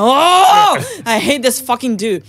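loud, high exasperated wail, easing off; retold boiling rage. Venting about the intruder to boyfriend, boiling mad. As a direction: let the fury burst out on the first word. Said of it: "I was boiling".

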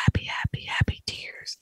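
whispered, fading repetition; soft whisper, mother overcome with joy; whispered happy tears. Retold or imitated. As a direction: breathe the words out like the tearful mom. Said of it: "She goes".

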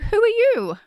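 high, loud, teasing who are you; playful mock question. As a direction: toss it to the guest with a grin. Playful host intro to guest.

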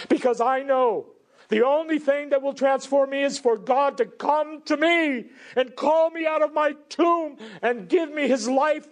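high, driving sermon voice; full-force preaching. Acted. Preacher urging fathers to seek godlier homes, full-force preaching. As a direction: cry it out to the congregation, pitch held high.

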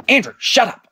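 a hammed-up mum shushing her husband; mum scolding. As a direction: mum slapping dad, squealing at him to shut up. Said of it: "She whacked".

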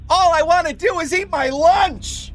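high, comic whiny quote voice; silly voice. Acted. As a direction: ham up a joke answer, pitched high. Joke answer quote in quiz.

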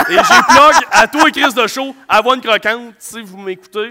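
annonce de commanditaire pour rire, appuyée et lente; fausse pub. Pretend. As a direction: vendre la marque comme à la radio. (fausse réclame lente et appuyée)

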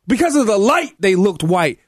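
high excited yelling; shouting. Real, not acted. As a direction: shout him down, worked up. Heated on-air argument.

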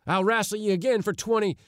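high, quick challenge; aggrieved shout. A narrator's reading. Givens shaking his fist at the lion, shouting. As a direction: shout it high and fast, itching for a rematch. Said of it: "shook his fist at the lion and shouted".

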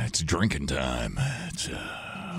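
low, slow sleazy come-on; creepy voice. Acted. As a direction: lean in like a creep. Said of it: "weirded out by your voice that you were doing".

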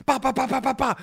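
high-pitched "ba" stutter; rapid-fire babble. Retold or imitated. Imitating an unhinged friend's intensity, high manic babble. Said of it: "he's just like".

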